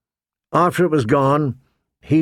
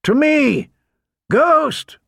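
high, ringing calls; calling out; 12 semitones higher, slower than usual. A narrator's reading. Man searches for his missing wolf, high-pitched shouts. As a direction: summon from afar, pitched way up. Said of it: "he called again".

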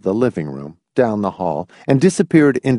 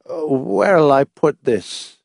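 put-on slow, higher-pitched querulous old man; 5 semitones higher, slower than usual. A narrator's reading. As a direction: ask fretfully, hunting for somewhere to put it. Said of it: "he asked querulously".

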